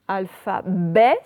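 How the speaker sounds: "Alphabet" très étiré; dictée lente. Shown as a demonstration. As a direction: allonger chaque syllabe de "Alphabet". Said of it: "dictée lente". (dicter lentement)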